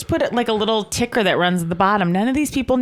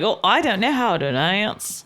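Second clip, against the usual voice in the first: put-on Cockney accent. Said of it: "that was not Cockney what I did".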